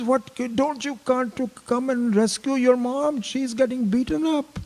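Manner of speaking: high, loud pleading little voice; desperate child. Retold or imitated. Three-year-old urging sister to rescue mom, high and frantic. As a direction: plead with alarm as a frightened small child.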